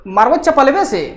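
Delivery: ऊँची डाँट वाली चीख; angry scolding. Acted. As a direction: scold a naughty child, snapping. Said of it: "तो".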